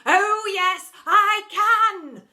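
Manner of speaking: high, loud retort; deputy head in a panto routine; panto shout. Acted. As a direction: shout it back panto style. Panto call and response.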